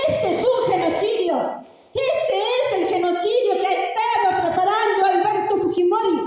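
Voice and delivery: high, urgent outcry; impassioned denunciation. Retold or imitated. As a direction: cry out the accusation to the press. Retells crying out her denunciation to reporters.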